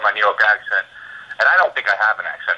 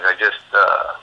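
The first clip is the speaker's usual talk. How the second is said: usual speaking voice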